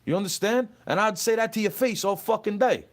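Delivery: heated, high-pitched defiance; angry challenge. Real, not acted. Challenging a troll to his face, angry and high.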